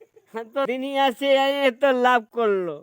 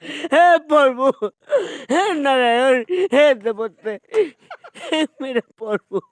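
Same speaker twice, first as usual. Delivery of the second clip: wailing prayer; 2 semitones higher, 5 dB louder than usual. Acted. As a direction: cry out to God in despair. Wailing appeal to the gods. (भगवान को ज़ोर से दुहाई)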